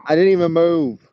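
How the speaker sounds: loud, high, exasperated protest; indignant yell. Real, not acted. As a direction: yelp it out in disbelief. Goat bites leg again.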